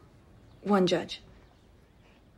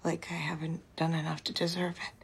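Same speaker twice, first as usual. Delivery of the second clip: soft whisper, close to crying; tearful whisper; 5 semitones lower, 9 dB quieter than usual. Acted. Confessing to a friend she feels undeserving, tearful whisper. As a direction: whisper through tears, ashamed. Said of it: "I don't know why I'm crying".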